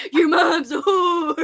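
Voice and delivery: slow, cheery sing-song insult; a light, playful take on the Nelfs' threat; jolly Nelf line. Shown as a demonstration. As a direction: toss it out like a jolly little creature. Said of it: "you gotta say it like that".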